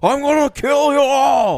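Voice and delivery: high, loud, slow screaming threat; mock Cage scream. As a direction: rant like a deranged villain. Mimicking Cage rant.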